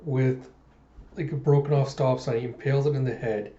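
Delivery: normal talking voice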